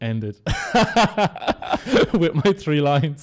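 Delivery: laughing through the words; high, loud laughing. Real, not acted. Laughing at own rap fumble.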